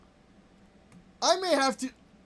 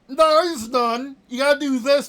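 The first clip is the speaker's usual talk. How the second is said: loud, frustrated insistence; emphatic yelling; 7 dB louder than usual. Real, not acted. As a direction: raise your voice to make the point. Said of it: "Sorry that I'm yelling".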